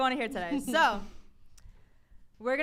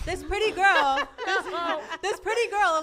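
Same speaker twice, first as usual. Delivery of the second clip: laughing; 6 semitones higher, 7 dB louder than usual. Real, not acted. Laughs while describing photo.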